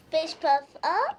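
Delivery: up lifted as a question; rising on up. Shown as a demonstration. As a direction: raise the voice on up. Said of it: "I like how you changed the up".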